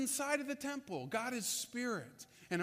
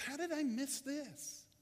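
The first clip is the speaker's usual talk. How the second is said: higher, slow, dumbfounded self-question; amazed inner thought; 5 semitones higher, 3 dB quieter, slower than usual. Retold or imitated. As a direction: let it dawn on him, slow and higher. Nicodemus's inner astonishment.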